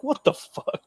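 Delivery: high, startled mock "what the fuck", gasped softly; imitating shocked disbelief. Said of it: "I'm like".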